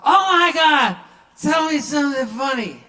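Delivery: put-on high, loud drunken squeal, begging for a joke. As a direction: gush like a tipsy fan.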